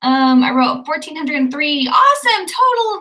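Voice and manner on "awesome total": excited. Real, not acted. Cheering her own word count.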